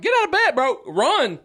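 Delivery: high, loud comic urging; urgent yell. Retold or imitated. Stranded boater hurrying a sleepy rescuer, high urgent yell. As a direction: holler it to rouse someone.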